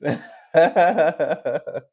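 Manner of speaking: real burst of laughter; loud, high laugh. Real, not acted. Reader laughs over boundaries, loud high laugh.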